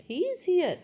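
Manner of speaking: "He is here" in a high questioning tone; statement words "He is here" asked as a question; questioning intonation. Shown as a demonstration. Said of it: "you are using tone of question".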